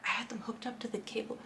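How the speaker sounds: unvoiced whisper; hushed, secretive aside. Real, not acted. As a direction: drop to a whisper as if confiding. Aside about the hooked-up cable.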